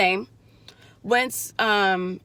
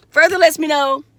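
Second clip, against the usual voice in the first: ordinary talk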